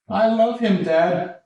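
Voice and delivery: high-pitched mock teen voice; lovesick teenage daughter. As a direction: whine it like a teen in love.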